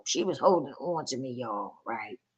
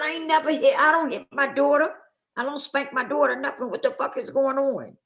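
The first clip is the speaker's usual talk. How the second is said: high, heated retold protest; insisting, pitched up in outrage; indignant denial; 9 semitones higher than usual. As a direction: defend yourself against the accusation. Retold angry confrontation with caseworker.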